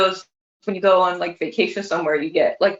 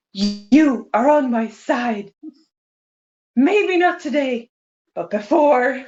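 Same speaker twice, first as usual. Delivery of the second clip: put-on slow, higher, scolding Swedish-accented English; mean Swedish accent; 3 semitones higher than usual. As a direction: deliver it like mom telling off the neighbor. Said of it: "she sounded super mean".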